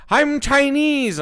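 high, drawn-out mock Chinese accent. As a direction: put on an exaggerated Chinese accent, stretching the words. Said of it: "I woke up one day and I'm like".

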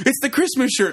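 put-on high, excited kids' cry; excited kids. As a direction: squeal it like an excited kid. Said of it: "they're like".

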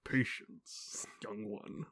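soft breathy dark mentor voice; calm gentle wise voice. Retold or imitated. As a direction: hush it down, slow and wise. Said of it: "Darth Vader's all like".

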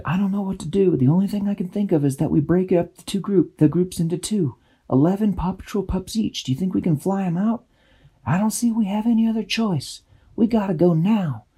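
high fairy voice, quick and urgent; urgent fairy plan. A narrator's reading. Dashy urging Tweak to split the pups, urgent. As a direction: press the plan fast. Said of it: "Dashy said".

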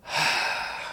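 long, breathy sigh. Acted. Traveller receiving supplies for the road, long sigh. Relieved character takes the gift.

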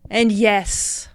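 slow sermon to the ocean; preaching. Acted. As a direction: preach it slowly with conviction. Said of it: "do a snippet of your speech".

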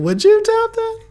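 very high, loud squeaked question; octaves-up incredulous question. Shown as a demonstration. Replaying the question for viewers, loud incredulous squeak. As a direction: shoot the voice right up, loudly. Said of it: "My voice had to go up a few octaves".

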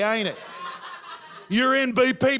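ordinary talk, nothing marked

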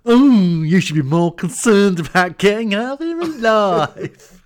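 camp, high and loud, drawn-out delivery; exaggerated comic impression, swooping high and slow; Kenneth Williams. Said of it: "he says it in a real Kenneth Williams voice".

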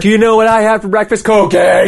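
high, loud, manic vlogger; wired and way too peppy; hyper YouTuber voice. Retold or imitated.

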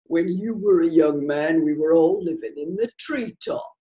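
dry, mocking barman voice; sarcastic barman. A narrator's reading. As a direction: sneer at the old-timer. Barman mocks old man.

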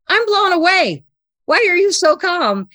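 high, loud amazed outburst; nearly shouting in disbelief; blown away. Retold or imitated. Stunned, confronting calm Stan.

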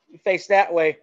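ordinary talk

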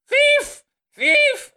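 high-pitched, loud cry of alarm; shout. Acted. Old lady raising the alarm over a robber, high shout. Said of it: "She goes".

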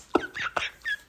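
silent wheezy laugh; breathless laugh. Real, not acted. Laughing at himself over wife's remark, breathless laugh. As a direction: laugh at yourself without voice.

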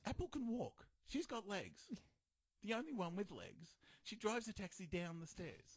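high, incredulous rant; real exasperation at silly logic; indignant disbelief. Real, not acted.

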